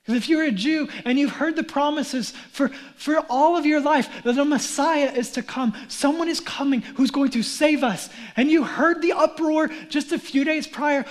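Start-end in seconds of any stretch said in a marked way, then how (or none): none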